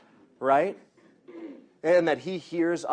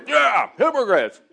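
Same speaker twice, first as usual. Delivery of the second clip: a hint of a hyped-up sidekick, higher and louder; echoing sidekick; 9 semitones higher, 8 dB louder than usual. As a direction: parrot the leader's words eagerly. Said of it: "he just repeated everything".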